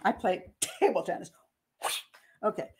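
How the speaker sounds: usual speaking voice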